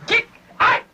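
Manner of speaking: high, loud barked order; drill sergeant yell. Acted. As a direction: scream the command at the recruit. Drill instructor yelling at recruit.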